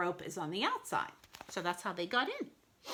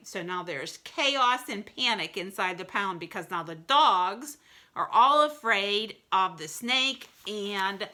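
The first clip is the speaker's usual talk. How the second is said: ordinary delivery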